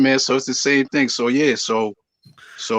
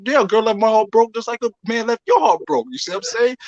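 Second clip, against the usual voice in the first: high, pressing point; heated protest; 9 semitones higher than usual. Real, not acted.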